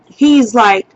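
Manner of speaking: ordinary talk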